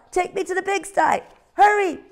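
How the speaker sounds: a tiny owl pretending there's an emergency; little owl's alarm. Ozzie urging the cow.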